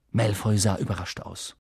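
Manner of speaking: ordinary delivery, nothing marked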